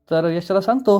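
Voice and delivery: plain reading voice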